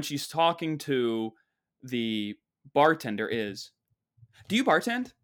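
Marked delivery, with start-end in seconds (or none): 4.5-5.1 condescending, high-pitched question; haughty question, voice lifted high; high and mighty. Retold or imitated. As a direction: look down your nose as you ask. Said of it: "but the tonality of it all".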